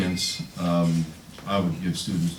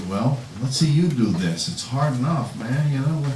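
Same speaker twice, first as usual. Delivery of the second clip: higher-pitched imitation of a challenging student; mock student complaint; 7 semitones higher, 4 dB louder than usual. Student challenging the writing teacher, imitated complaint. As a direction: voice a student griping back at the teacher.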